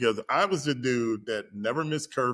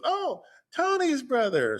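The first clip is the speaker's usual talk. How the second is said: much higher, sing-song woman's voice on "Tony's brother"; mimicking her recognition. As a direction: play her going "oh, that one" in a high lilt. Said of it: "So she goes".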